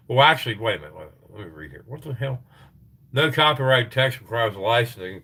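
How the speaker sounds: ordinary talk, nothing marked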